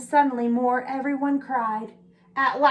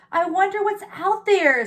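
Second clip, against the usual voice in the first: lightly voiced little owl, higher; curious little owl; 8 semitones higher, 4 dB louder than usual. A narrator's reading. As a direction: wonder aloud as a tiny owl.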